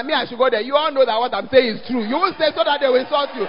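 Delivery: usual speaking voice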